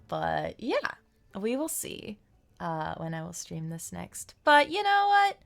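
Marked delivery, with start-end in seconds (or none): 4.4-5.4 high, loud perky sign-off; upbeat. Real, not acted. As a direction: brighten up for the goodbye.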